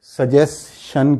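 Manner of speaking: "سجیشن" slowly as su-jes... shun; common mispronunciation. Shown as a demonstration. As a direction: end "سجیشن" on SH, syllables apart. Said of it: "جس کو ہم". ("سجیشن" کو "سجیس شن")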